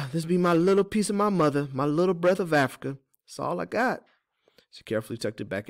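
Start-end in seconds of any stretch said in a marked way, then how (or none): 0.1-4.0 high character voice; folksy Teeny, cherishing. Acted. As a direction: treasure a keepsake in a high folksy voice.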